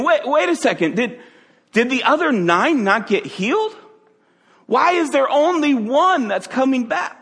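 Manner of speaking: a hammed-up mild exasperation, wait a second; mock aggravated Jesus. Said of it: "He was kind of aggravated".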